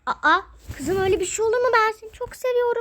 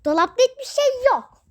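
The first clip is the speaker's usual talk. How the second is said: higher and louder, pressing the point; insistent repeat; 5 semitones higher, 5 dB louder than usual. Acted. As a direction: Dolapta hiçbir şey yok, repeated louder and higher. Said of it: "repeated louder". (daha yüksek sesle, ısrarla)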